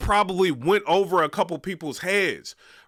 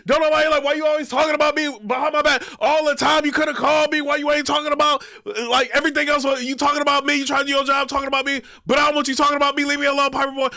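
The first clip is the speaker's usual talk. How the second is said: mocking Briz; 7 semitones higher, faster than usual. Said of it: "Why you keep talking to me?".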